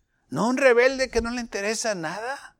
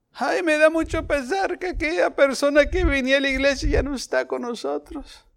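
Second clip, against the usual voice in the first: higher whiny mock grief of a churchgoer; mock lament; 7 semitones higher than usual. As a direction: mimic a sighing, self-pitying member.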